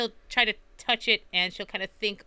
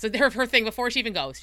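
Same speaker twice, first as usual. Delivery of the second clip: ordinary talk, nothing marked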